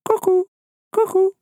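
very high, hooted two-note bird call; cuckoo call. A narrator's reading. Bird sounding from deep within the forest, high cuckoo call. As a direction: hoot it like a cuckoo. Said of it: "A sound came from deep within the forest".

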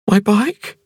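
high woman's voice, alarmed question; sudden alarm about the bike; crying out. A narrator's reading. Ping to Cavalier, high alarmed cry about her bike. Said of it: "she cried".

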